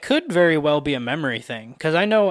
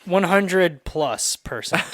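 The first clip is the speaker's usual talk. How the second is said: slow emphatic; 4 semitones higher, much slower than usual. Real, not acted. Teasing guess about friend's ADHD.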